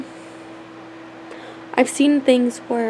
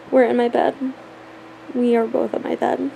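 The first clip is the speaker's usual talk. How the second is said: ordinary talk, nothing marked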